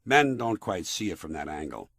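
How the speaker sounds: normal talking voice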